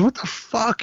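high, breathy startled mock shout; startled mock cry. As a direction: cry it out as the man under attack. Willie being attacked, retold.

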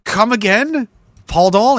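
high incredulous questions; mock disbelief. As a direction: act scandalised, pitch up. Mock shock at Google definition.

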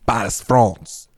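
put-on slowly drawn-out French-accented Paris, France; slow mock French accent. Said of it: "just me saying things".

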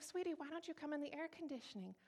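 high, soft, sweet invitation; lilting Southern church-lady invitation, kept soft; Southern pastor's wife. Retold or imitated. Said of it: "Southern pastor's wife".

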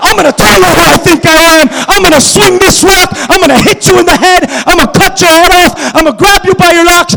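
loud, high, fierce battle cry; roaring threat. Acted. David roars threats at Goliath, fierce and loud. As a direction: roar it at the giant.

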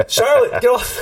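high, laughing schoolboy call; kids' taunting call. Retold or imitated. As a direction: egg her on, laughing. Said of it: "my boys would always say".

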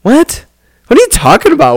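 high, baffled "what?"; disbelieving, rising to a squeak; incredulous. Retold or imitated. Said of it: "I'm like".

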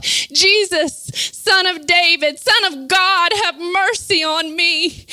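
fervent cry to the Lord; preacher acting out a desperate plea. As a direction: call out in urgent appeal. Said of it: "cry out".

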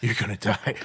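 lightly voiced low inner doom; little inner voice. Inner voice warns the panicking speaker, in a low undertone. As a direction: drop the pitch for a retold dark thought. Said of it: "this little voice in my head".

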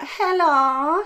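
high, drawn-out Jamaican hello; Jamaican accent. Retold or imitated. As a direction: greet like a Jamaican neighbour, voice lifted. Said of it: "that was a Jamaican accent".

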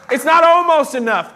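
full-voiced sermon emphasis; emphatic preaching. Real, not acted. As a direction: drive it home to the room. Preaching climax.

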